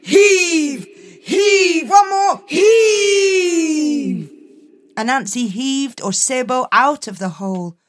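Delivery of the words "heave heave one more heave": straining heave-ho shouts, higher and louder; tug of war. Acted. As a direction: haul hard on each heave. Hauling leopard up by rope.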